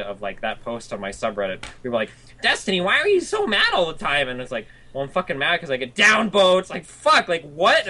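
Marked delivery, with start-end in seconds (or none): 2.4-4.3 high-pitched imitation of a nagging commenter; mocking whiny critic. Mimics whining subreddit posters. 4.9-7.9 loud, high-pitched indignant outburst; exasperated retort. Retold or imitated. Acts out his exasperated reply.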